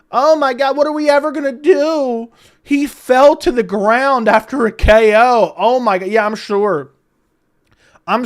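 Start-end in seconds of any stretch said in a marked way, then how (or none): 0.1-6.1 sarcastic hammed-up panic; mock outrage. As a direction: pretend to be horrified, louder.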